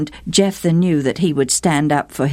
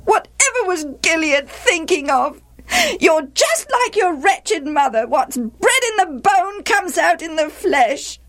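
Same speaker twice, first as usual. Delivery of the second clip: high, railing stepmother; bitter scolding. A narrator's reading. Stepmother berating Jephthah about his birth, bitter and scolding. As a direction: pour out bitter reproach at a high pitch.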